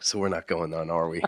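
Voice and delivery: low soft dry question; deadpan it softly and low; quiet. Retold or imitated. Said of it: "she wrote back".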